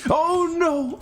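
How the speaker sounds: high-pitched startled yelp; startled cry. Real, not acted. Player reacting to a mishap in the game, startled cry. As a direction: cry out as it suddenly goes wrong.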